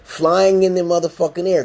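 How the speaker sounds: normal talking voice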